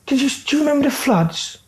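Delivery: a high, odd caricature of a hard man asking a question; Charlie Bronson impression. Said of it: "He goes".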